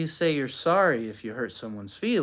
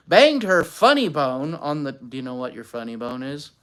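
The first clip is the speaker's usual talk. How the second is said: ordinary delivery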